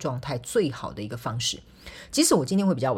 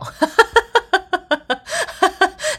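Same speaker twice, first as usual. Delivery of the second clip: high laugh; 9 dB louder than usual. Real, not acted. Laughs at own lazy day.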